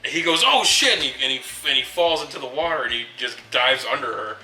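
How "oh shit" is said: high, loud imitation of alarm; panicked mock yell. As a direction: blurt it out loud like a startled character. Retells actor's panicked reaction again.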